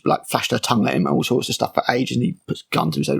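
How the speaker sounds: ordinary delivery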